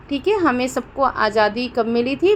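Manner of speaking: ordinary talk, nothing marked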